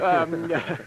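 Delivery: embarrassed laugh. Acted. Doctor laughs at marshal's joke.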